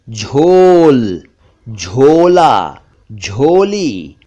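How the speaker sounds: झोल, झोला, झोली with long rounded "o"; stretched O sound. Shown as a demonstration. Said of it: "इन सभी में ओ साउंड है".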